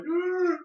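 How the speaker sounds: high, short startled cry; frozen startled yell. Retold or imitated. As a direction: yelp like a fan caught mid-catch. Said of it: "being like".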